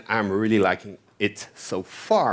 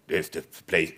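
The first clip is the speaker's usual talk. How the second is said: low, quieter mock conference-speaker voice; imitating Josh Long; 8 semitones lower, 5 dB quieter than usual. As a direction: drop your voice low and imitate a famous speaker's catchphrase. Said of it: "he always do".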